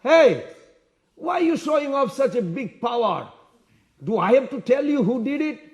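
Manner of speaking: put-on high, indignant challenge from a young prince; defiant challenge. Young prince confronts the showy warrior, indignant and high. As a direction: burst in loudly, then keep the pitch up.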